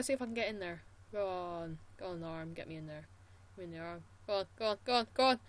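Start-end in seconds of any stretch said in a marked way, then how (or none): none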